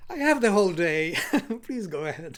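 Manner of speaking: real laughter through the words; laughing reassurance. Real, not acted. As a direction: laugh it off and wave them on. Laughing while reassuring the host.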